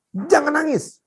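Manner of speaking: high, urgent angry command; retold angry orders. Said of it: "saya marah".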